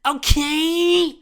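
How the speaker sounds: very high, loud, slow kiddie shriek; stretched-out mock tantrum screaming; stressed-out kid. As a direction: yell at mom in a full childish tantrum. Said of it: "And I'm all stressed".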